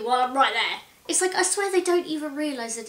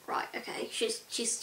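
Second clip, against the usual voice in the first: hushed whisper to mum as the woman approaches; she's coming over, under the breath; 11 dB quieter than usual. Retold or imitated. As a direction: whisper so the stranger won't hear. Nervous aside to mum in cafe.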